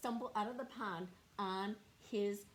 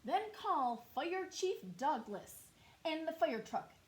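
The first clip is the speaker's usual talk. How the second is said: farmer shouting; 6 semitones higher, 3 dB louder than usual. Acted. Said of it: "the farmer shouted".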